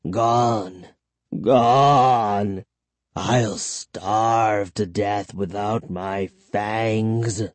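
low, drawn-out vampire lament; mournful vampire cry. A narrator's reading. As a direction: moan slowly over a terrible loss. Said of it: "he cried".

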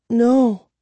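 usual speaking voice

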